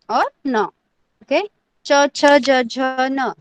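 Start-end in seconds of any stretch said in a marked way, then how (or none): 1.9-3.3 each letter spaced out; slow recitation. Shown as a demonstration. Teacher reciting second row slowly to class. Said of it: "ये line सबको समझा है क्या?".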